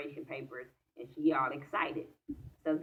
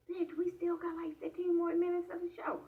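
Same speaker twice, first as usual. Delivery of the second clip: much higher, thrilled squeal; excited squeal; 13 semitones higher than usual. Retold or imitated. A viewer yelling at the TV for Holly to stay dead, squealing high. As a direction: squeal it out, too thrilled to hold back. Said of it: "I'm getting all excited".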